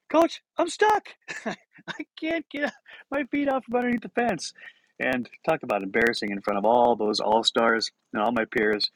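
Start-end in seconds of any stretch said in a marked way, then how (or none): 0.1-4.5 slow, high-pitched wail; helpless young player. Retold or imitated. Boy stuck at the fence to coach, high helpless wail. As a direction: call out as a stuck kid, drawn out and pitched way up. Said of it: "I said".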